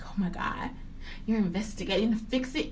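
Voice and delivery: breathless, hushed amazement; amazed reaction. Retold or imitated. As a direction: gasp it out in disbelief. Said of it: "my real reaction".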